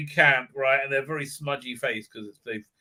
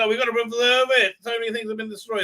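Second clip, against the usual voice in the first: higher, louder, rushed mock fretting; mock urgency; 6 semitones higher, 4 dB louder, faster than usual. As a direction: act out a flustered plea. Acted worried villager's plea.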